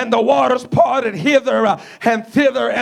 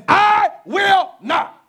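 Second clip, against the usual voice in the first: stretched, high, slow shout on I will not; shouted refusal; 3 semitones higher than usual. Acted. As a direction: hold it out like a stubborn vow. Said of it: "he looks at him in his face again and says".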